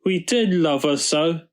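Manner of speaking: put-on high sad child voice; tearful. A narrator's reading. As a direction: lament like children about to cry. Children lament their stolen car.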